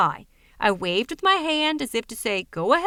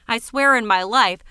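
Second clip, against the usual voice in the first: ordinary delivery, nothing marked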